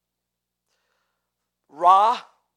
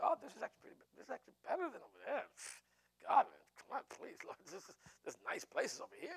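mock-contented settler, softer; comfortable settler; 3 semitones higher, 9 dB quieter, faster than usual. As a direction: voice a cozy newcomer, half under the voice.

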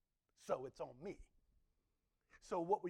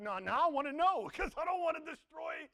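louder, higher, laughing demand; eager congregation; 10 semitones higher, 8 dB louder than usual. Retold or imitated. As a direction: plead like you can't wait to know. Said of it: "'cause you're like".

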